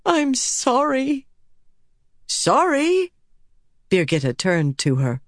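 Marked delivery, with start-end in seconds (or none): 0.1-1.3 high, slow tearful apology; drawn-out, high-pitched sorrow; through tears. A narrator's reading. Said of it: "Elaine said through tears". 2.3-3.1 high, rising surprise; astonished, pitched way up; incredulous question. A narrator's reading. Revived friend answers a tearful apology.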